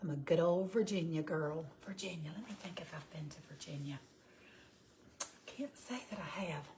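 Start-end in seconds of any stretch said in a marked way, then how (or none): 1.8-6.6 hushed whisper, thinking aloud. Real, not acted. Wondering to herself, in a whisper, whether she has been to Virginia. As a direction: whisper it while you think.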